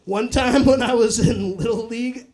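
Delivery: ordinary talk, nothing marked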